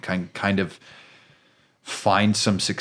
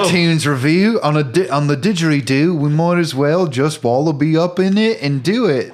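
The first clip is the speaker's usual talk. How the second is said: mock Australian; 8 semitones higher, 6 dB louder than usual. Joking in mock Australian accent.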